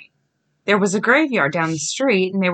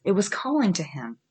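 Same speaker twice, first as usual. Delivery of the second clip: ordinary delivery, nothing marked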